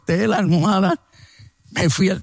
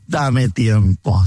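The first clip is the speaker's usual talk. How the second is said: reluctant plea; 7 semitones lower, much slower than usual. Retold or imitated. Stalling plea to God.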